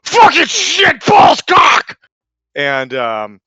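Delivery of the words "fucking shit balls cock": sudden high shouted swearing, slow; imitated tic outburst. Tourette's customer shouting swears in the store. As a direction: blurt the swears out in a high yell.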